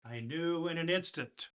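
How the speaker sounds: lively storybook recital with dramatic pauses; poem reading. Acted. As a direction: read it like a bedtime poem to children. Reads the classic poem aloud.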